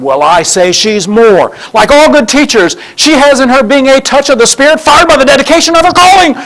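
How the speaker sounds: high, loud rapturous praise; gushing Linus. Acted. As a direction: swoon over the teacher, rising high. Linus gushing about his teacher.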